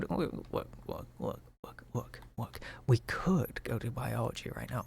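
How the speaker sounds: whispered to himself. Real, not acted. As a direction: breathe the words out, no voice. Whispering to self while playing.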